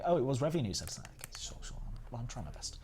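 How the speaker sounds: normal talking voice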